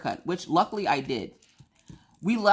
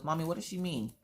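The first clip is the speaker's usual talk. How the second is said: put-on softer, small child asking; little son's voice; 3 semitones higher, 7 dB quieter than usual. Young son asking his mother, soft and puzzled. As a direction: ask it softly like a confused little boy. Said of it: "and he goes".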